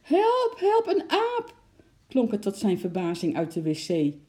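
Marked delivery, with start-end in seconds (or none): 0.0-1.5 very high, loud frightened shriek; terrified cry for help. A narrator's reading. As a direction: shriek at the monkey in panic. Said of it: "zijn vrouw om hulp roepen". (angstig gillend om hulp)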